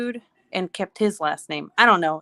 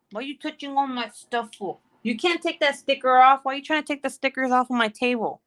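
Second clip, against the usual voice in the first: higher, fussy parent voice; pitched-up nagging at a little one; playful scolding; 5 semitones higher than usual. Real, not acted. Mom to child peeling stickers off her table, playful scolding.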